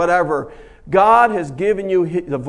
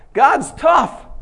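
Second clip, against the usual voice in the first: much higher, loud exclamation; excited shout; 7 semitones higher, 5 dB louder than usual. Real, not acted. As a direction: shout it in awe. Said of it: "exclaims, then repeats".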